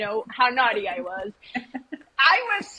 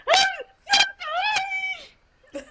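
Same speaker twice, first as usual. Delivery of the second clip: mock crying; faster than usual. Pulled-over teen apologizing to the officer, hammed-up crying. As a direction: wail it in an exaggerated tearful squeal. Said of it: "I'm crying".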